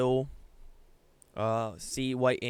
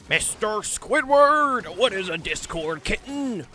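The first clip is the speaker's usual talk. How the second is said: slow, higher cartoon sponge voice; cartoon character voice; 4 semitones higher, slower than usual. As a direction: drag it out like a goofy cartoon kid. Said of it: "I'll do the voice better".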